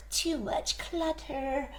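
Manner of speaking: a tired rabbit sighing inwardly; Rabbit thinking. Acted. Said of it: "Rabbit thought".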